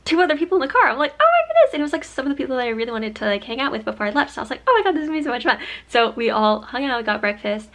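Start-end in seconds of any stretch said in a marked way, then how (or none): 1.2-1.7 high, loud retold squeal; delighted surprise. Finding two more friends in the car, squealed surprise. 4.7-5.6 loud, higher gush of delight; retold excitement. Thrilled that wanted friends came along, gushing excitement.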